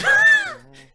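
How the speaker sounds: very high, loud screech; piercing sustained shriek; scream. Acted. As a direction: let out a shrill scream. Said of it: "in your mouth and scream".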